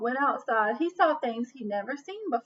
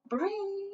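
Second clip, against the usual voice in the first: high mock alarm ring; mock alarm clock; 8 semitones higher, 4 dB quieter than usual. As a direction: imitate the alarm clock ringing.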